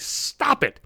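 usual speaking voice

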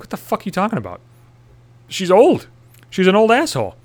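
high, incredulous protest; indignant retort. Retold or imitated. Defending his post to his girlfriend, indignant high protest. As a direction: argue back in mock outrage.

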